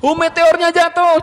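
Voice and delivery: high, loud, stretched-out alarm; children's storytelling. Shown as a demonstration. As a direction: cry out a falling meteor for children. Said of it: "saya pakai gitu-gitu". (seruan dongeng yang heboh dan melengking)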